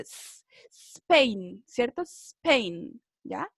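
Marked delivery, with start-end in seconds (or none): 0.0-1.6 Spain begun on a long hissed S, no E before it; pure initial S. Shown as a demonstration. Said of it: "Uno hace el sonido de la S solamente". 2.0-3.0 Spain started straight on the S; no E before the S in Spain; pure initial S. Shown as a demonstration. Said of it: "Uno hace el sonido de la S solamente".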